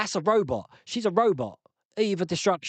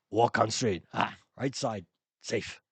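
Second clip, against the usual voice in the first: lower, halting mentor voice with broken English; Mister Miyagi; 7 semitones lower than usual. Retold or imitated. Streamer quoting the Karate Kid mentor, lightly lowered. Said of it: "Sounded a bit like Mister Miyagi".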